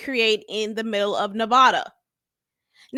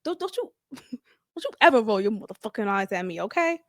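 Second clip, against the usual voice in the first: put-on stern boyfriend; 3 semitones lower than usual. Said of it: "He said".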